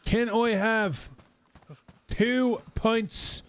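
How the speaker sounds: high, slow, over-careful English; slow tourist English. Retold or imitated. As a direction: drag each word out for a foreigner. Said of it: "slowing down their English tune".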